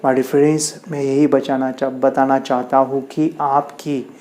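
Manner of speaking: ordinary delivery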